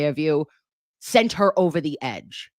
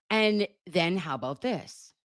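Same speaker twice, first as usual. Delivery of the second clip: ordinary talk, nothing marked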